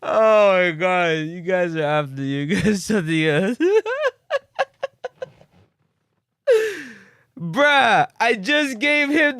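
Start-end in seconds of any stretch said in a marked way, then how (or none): 3.6-5.2 high, helpless burst of laughter; cracking up for real; squealing laugh at the chat. Real, not acted.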